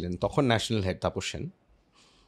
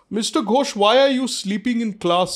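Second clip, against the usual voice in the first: high, louder imitation of a scolding priest; stern vice principal; 9 semitones higher, 5 dB louder, slower than usual. Vice principal questions student caught sleeping, stern. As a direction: play the vice principal demanding an explanation.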